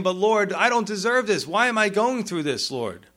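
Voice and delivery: mock anguished plea of the suffering; pleading complaint. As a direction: plead like someone hurting and confused. Believer crying out to God.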